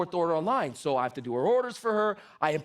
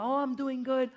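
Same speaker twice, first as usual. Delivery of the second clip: put-on higher-pitched voice of an old Italian nonna; elderly Italian grandmother; 6 semitones higher than usual. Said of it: "She's Italian. She's ninety-one years old".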